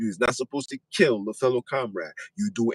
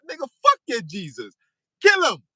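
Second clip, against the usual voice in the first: higher voice rising into a loud yell at the end; defiant rebel shout; 8 semitones higher, 4 dB louder than usual. Retold or imitated. Ancestor rejecting the missionary's Jesus, a rising furious shout. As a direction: spit back the refusal, then order the kill.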